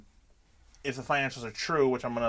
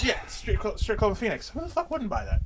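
higher, gleeful hype; excited; 8 semitones higher than usual. Real, not acted. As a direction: sell it, all excited. Excited about strip club.

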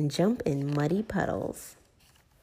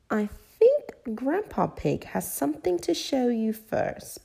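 granny voice; 8 semitones higher than usual. Acted. Granny Pig speaking.

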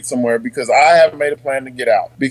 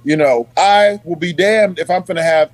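ordinary talk

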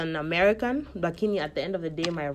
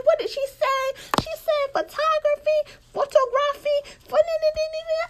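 high, loud mocking American teen voice; mocking classmates; 5 dB louder than usual. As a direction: mimic the kids laughing at her accent. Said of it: "walikuwa wananichekelea".

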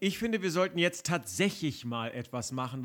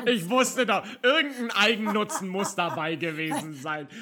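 laughing while teasing; 4 semitones higher, 4 dB louder than usual. Real, not acted. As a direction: chuckle through the words, teasing. Neckt sie über Eigennutz.

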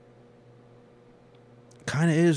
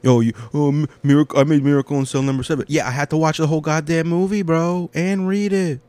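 higher, louder, rushing protest; indignant rant; 8 semitones higher, 6 dB louder than usual. Real, not acted. Ranting at the filmmaker who made him cry, loud. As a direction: shout him down in disbelief.

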